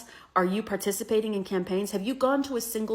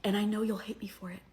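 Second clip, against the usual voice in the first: fast breathy mock confession whispered; 7 dB quieter, faster than usual. Voter mocked confessing to viewers, fast whisper. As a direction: rush it out in a fake-humble whisper. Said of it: "mocking voter's confession".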